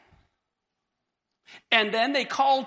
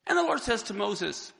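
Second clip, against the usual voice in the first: usual speaking voice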